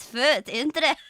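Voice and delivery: "Foot" and "Interest" loud in mock Scottish, breaking into laughter. Shown as a demonstration. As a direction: belt "Foot" in silly Scottish. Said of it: "That says you guys".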